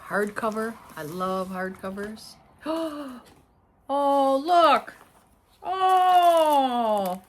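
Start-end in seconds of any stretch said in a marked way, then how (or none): 2.6-3.2 sharp gasp of surprise; delighted gasp. Real, not acted. Unboxing a prize, delighted surprise. 3.9-7.2 high, loud gushing; delighted surprise. Real, not acted. Discovering extras with the prize book.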